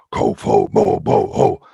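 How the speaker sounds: very low, slow, gruff rhino-alien grunts; deep guttural alien law-enforcer voice; gruff alien creature voice. Acted. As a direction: growl it out like a big gruff rhino in a spacesuit. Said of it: "the first lines were something like".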